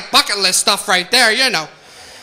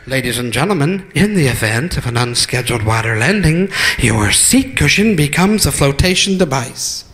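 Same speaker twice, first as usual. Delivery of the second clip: much lower, formal intercom voice; flight attendant announcement; 9 semitones lower than usual. Retold or imitated. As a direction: deliver it deadpan like a cabin PA. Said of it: "She had said".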